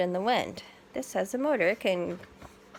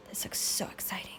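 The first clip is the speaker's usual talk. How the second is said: hushed, thrilled whisper; breathless little whisper of delight; excited whisper; 7 dB quieter, faster than usual. Real, not acted. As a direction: whisper it like a secret treat. Gasps, whispers excitedly to child.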